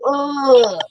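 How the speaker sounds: long, high-pitched moan; imitated moan. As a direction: mimic a patient moaning down the hall. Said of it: "he just kept moaning".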